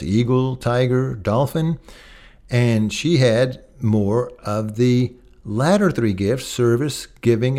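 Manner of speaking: usual speaking voice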